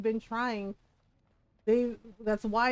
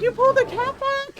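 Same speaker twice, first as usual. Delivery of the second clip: very high, loud disbelieving question; incredulous shout; 9 dB louder than usual. Retold or imitated. Gamer imitating husband in disbelief at her move, high loud question. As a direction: squeal it in disbelief. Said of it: "He's like".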